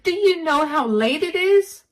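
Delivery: yelling in relief. Acted. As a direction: scold him out of fear and relief. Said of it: "I ended up yelling at him".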